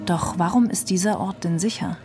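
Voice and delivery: usual speaking voice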